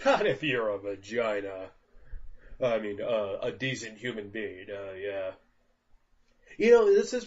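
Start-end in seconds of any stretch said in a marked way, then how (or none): 0.0-1.7 laughing. Retold or imitated. Mock correcting friend.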